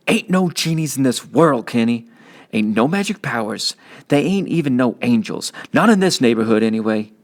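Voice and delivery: big brother setting him straight; teen big brother. Acted. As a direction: talk down to your kid brother, tough but caring. Byron lecturing his little brother.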